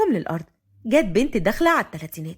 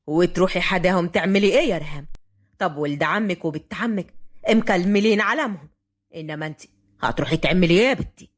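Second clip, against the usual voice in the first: low-pitched elder, dismissive question; questioning grandfather; 7 semitones lower than usual. A narrator's reading. Grandfather questions Riham's wish, low and dismissive. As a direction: question her in a lowered voice.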